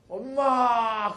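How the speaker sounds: long drawn-out 엄마 called high and loud; child calling mom. Acted. Child greeting mom at the door, long joyful call.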